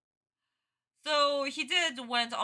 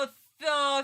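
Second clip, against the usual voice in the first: usual speaking voice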